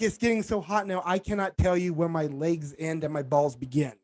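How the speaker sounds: normal talking voice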